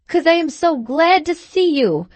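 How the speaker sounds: high, effusive character voice; gushing. A narrator's reading. Trotter explaining his tears to Sam, high gushing. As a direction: gush with overdone gladness.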